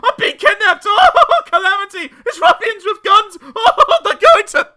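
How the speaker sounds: high, loud hysterical wail; panicked screaming. A narrator's reading. Mister Jelly imagining ruffians with guns, screaming hysterically. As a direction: scream in mounting terror until cut short. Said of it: "he screamed".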